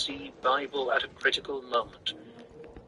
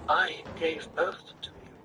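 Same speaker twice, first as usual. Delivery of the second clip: calming answer; 4 semitones higher, 5 dB quieter than usual. A narrator's reading.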